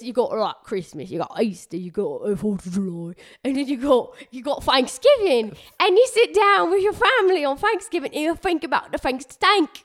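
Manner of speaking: rambling mock English accent; hammed-up English accent, th turned to f; female English accent. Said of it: "like, female accent take".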